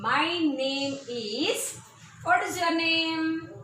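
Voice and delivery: My name is and What is your name? drawn out slowly; slow model sentence. Shown as a demonstration. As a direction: stretch each word so the class can copy. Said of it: "You must pronounce after me".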